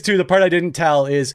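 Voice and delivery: ordinary talk, nothing marked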